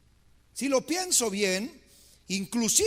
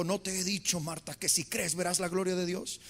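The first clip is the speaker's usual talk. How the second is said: softer, lower reminder; gentle rebuke; 6 semitones lower, 6 dB quieter than usual. Retold or imitated. Jesus quietly reminding Marta, gently low. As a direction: remind her gently, calmly.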